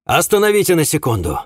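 high call to halt; shouted order. A narrator's reading. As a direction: shout to stop the driver. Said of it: "я крикнул".

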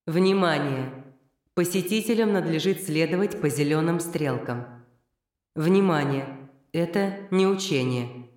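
slow formal PA announcement; evacuation loudspeaker. Acted. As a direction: announce it over the speakers. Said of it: "Голос из громкоговорителей".

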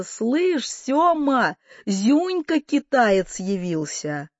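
high, drawn-out calling out; shouted announcement. A narrator's reading. Aunt announcing nephew to her husband, calling. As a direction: call it across the yard, high. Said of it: "и закричала".

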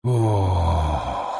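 low drawn-out moan; ghostly moan. A narrator's reading. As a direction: let out a hungry groan. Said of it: "застонал призрак".

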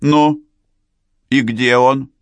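loud, high puzzled demand; bewildered. A narrator's reading. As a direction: call out in bewilderment. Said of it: "недоумевающие голоса".

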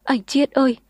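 put-on girl crying over him. A narrator's reading. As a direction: wail his name. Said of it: "cô khóc ầm lên".

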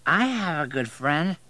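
high, slow slurring; drunken slur, drawn out; slurred drunk. A narrator's reading. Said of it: "slurring his words".

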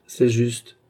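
ordinary delivery, nothing marked

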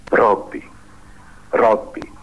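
a touch of a sob, pitch rising on each call; sobbing. A narrator's reading. The wretched man sobs to his master, mildly tearful.

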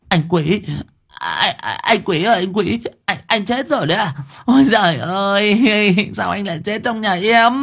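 high-pitched stammering wail; trembling panic. A narrator's reading. Hoàng Anh shaking Quý she thinks dead, panicked wail. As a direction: wail in fright, stumbling over the name. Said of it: "lao tới run bần bật lay".

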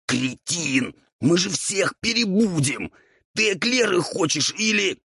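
high, raspy angry outburst; strained ghoul rage, climbing very high; Roul angry. A narrator's reading. Flour-covered ghoul berates devil, angrily. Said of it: "Роуль со злостью произнёс".

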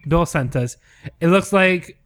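normal talking voice

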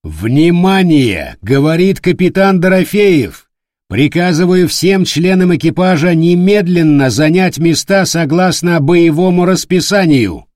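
loud, commanding ship announcement; loud confident command. Acted. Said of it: "громким, уверенным голосом".

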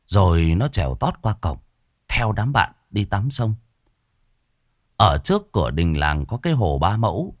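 ordinary delivery, nothing marked

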